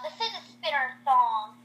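usual speaking voice

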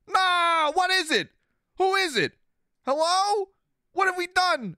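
high, loud startled questions rapid-fire; panicked calling out to a missing duck; alarmed. Real, not acted. Streamer alarmed at a missing duck, loud high panic.

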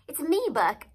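high, loud urgent call; shouted. A narrator's reading. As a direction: call out to be recognized. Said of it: "I shouted".

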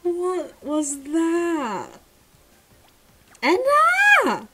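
slow high shout rising to a loud shriek; stunned shout. Real, not acted. Streamer shocked by another gacha pull, shouting. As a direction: drag it out, then shriek the name.

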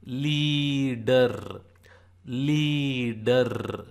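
"leader" stretched as leee-derr; demonstrated long vowel. Shown as a demonstration. Teacher shows lee as in leader, slow "leeeader". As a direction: hold the ee of "leader", then a rolled ending. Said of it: "Or like L E A in".

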